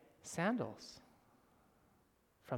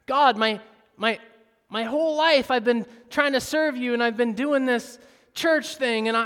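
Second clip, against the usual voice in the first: loud, high, stammering self-pity; whiny complaining prayer; 6 semitones higher, 9 dB louder than usual. Retold or imitated. Churchgoer whining to God about deserved blessings, stammering. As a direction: complain to God like a spoiled child. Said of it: "Don't we do this with God sometimes?".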